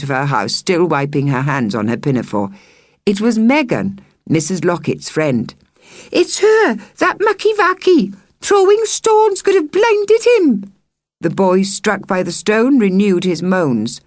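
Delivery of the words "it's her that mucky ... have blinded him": very high, loud whiny telling-on; tattling child. Acted. Child tells Megan on the evacuee, high and whiny. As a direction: whine it like a tattletale.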